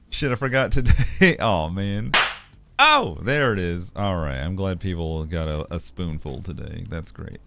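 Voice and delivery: normal talking voice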